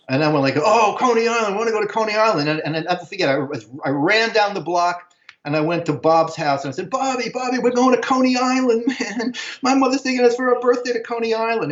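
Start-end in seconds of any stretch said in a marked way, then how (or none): 0.6-2.5 high, eager exclamation, re-enacted; excited kid. Boy hearing mother's Coney Island offer, excited. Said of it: "we're so excited". 6.9-11.7 put-on high, breathless-with-news kid re-enactment; excited kid. Boy telling best friend about Coney Island, excited. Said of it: "we're so excited".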